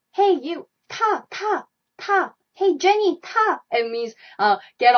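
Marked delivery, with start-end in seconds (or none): 0.1-3.6 high, bright call to hop in; calling out from the car to a friend. Retold or imitated. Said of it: "like, you just say".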